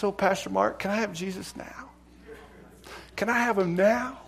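put-on soft, high voice of an eager child; pleading boy. Camp boy asks his pastor for Jesus, soft high pleading. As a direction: imitate a small boy begging, rising on each question. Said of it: "he was crying in front of all his buddies".

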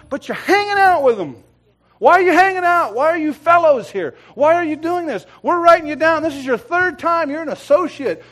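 high, loud interrogating cop; confronting police officer. Acted. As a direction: challenge them forcefully, firing accusations. Acted gang-unit officer confronting suspects.

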